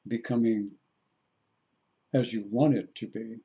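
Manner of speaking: slow, soft meditation voice; guided meditation. Real, not acted. Guiding listeners into the heart center, slow guided meditation. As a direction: lead them gently inward, with long pauses.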